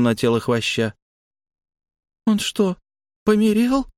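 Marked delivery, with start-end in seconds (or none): 2.3-3.9 put-on high, small child's voice; little girl voice. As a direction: ask it like a curious little girl.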